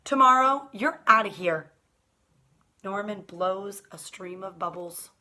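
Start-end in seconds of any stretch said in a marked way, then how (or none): 0.0-1.7 loud playful threat; mock stern warning. Boy warns his goldfish he'll be traded, loud. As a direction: warn the fish, boldly. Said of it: "I tell him".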